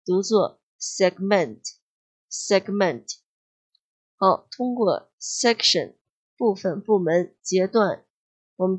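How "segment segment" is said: segment as seg-MENT, slowly demonstrated; second-syllable stress. Shown as a demonstration. Teacher showing students the verb segment, stressed seg-MENT. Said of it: "重音在第二个音节上".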